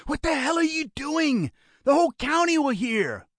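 high, strained urgency; urgent hissed shout. A narrator's reading. Brother scolding the gunman for firing, urgent hissed shout. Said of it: "hissed in an urgent, whispered shout".

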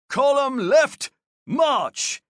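high, loud drill command, slow; shouted order. A narrator's reading. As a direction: call it to the whole column. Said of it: "Alario ordered".

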